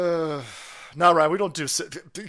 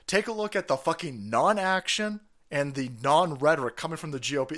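usual speaking voice

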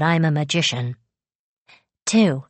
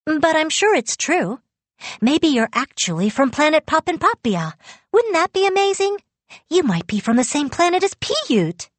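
high, eager rush of hope; excited; 10 semitones higher than usual. A narrator's reading. Girl telling Yu he may share Piyut's planet, excitedly. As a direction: lift the pitch with growing excitement. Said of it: "I said excitedly, leaning forward".

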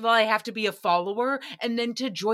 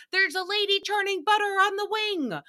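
much higher comic panic voice; Kristen Wiig impression; 10 semitones higher than usual. Host quoting a Kristen Wiig sketch in a high panicked voice. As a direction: do the frantic airplane sketch lady. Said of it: "comparable to Kristen Wiig".

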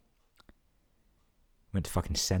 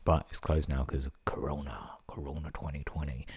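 whispered aside; 4 dB quieter than usual. Real, not acted. Aside about the closed restaurant.

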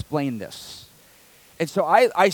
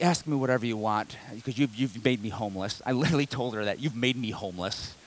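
defeated; 7 semitones lower, 9 dB quieter than usual. Retold or imitated. Telling lender she made him homeless.

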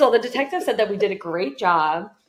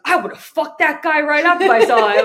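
mock bravado; 7 semitones higher, 7 dB louder than usual. Retold bravado threat to a bystander.

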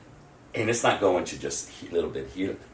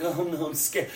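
small, higher mock-frightened voice; mock scared; 8 semitones higher than usual. Believer facing the end times, mock scared. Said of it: "quaking in your boots".

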